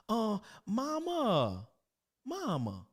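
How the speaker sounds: drawn-out high call for mama; flustered grown son calling mama. Acted. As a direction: call out slowly for mother, lost.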